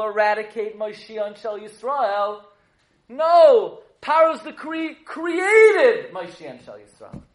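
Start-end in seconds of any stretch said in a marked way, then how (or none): 3.1-7.2 loud, high, triumphant proclamation; raised-voice sermon climax; emphatic preaching. Real, not acted. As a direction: thunder the reversal at the room. Preaches the reversal at full force.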